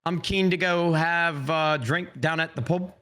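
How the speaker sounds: a clumsy mock Australian accent, slow; bad Australian accent. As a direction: attempt an Australian accent and miss. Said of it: "that wasn't Australian".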